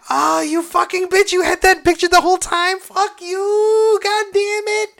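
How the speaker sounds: very high, whining tearful outburst; mock crying. Viewer cursing Coco for having the picture, mock crying. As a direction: squeal the curses as if bawling. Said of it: "I'm just crying even more".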